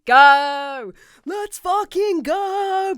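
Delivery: drawn-out hyped cheer; excited. Real, not acted. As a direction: stretch it out, pumped up. Hyped start to video.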